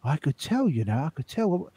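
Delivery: lightly voiced West Country friend; imitating Reeves. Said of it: "he said".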